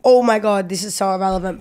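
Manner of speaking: ordinary delivery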